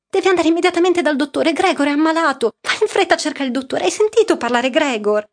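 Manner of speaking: frantic rushed orders; urgent mother. A narrator's reading. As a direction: hurry her off in a panic. Mother urgently sends daughter for doctor.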